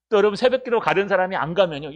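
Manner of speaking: ordinary talk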